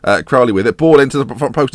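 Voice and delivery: usual speaking voice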